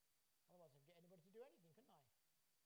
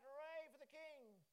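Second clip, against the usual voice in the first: slow, drawn-out cheer, higher; 5 semitones higher, 6 dB louder, slower than usual. Shown as a demonstration. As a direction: lead the crowd in a cheer, slowly. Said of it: "Cheer and shout".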